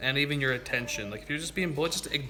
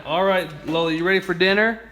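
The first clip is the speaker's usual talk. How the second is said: louder raised call to the dog; yelling across the room; 4 semitones higher, 8 dB louder than usual. Real, not acted. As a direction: call out to Lola across the house. Calling Lola to dinner.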